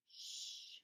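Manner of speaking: unvoiced hiss for silence; whispered shh; shushing. A narrator's reading. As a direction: hush someone sharply under your breath. Hushing outside classroom.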